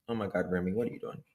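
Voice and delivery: ordinary delivery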